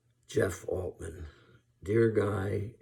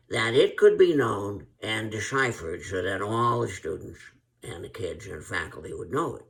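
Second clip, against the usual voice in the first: Nutty Professor; 2 semitones higher, 6 dB louder than usual. Retold or imitated. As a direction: lecture like a flustered nutty professor. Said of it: "as the nutty professor".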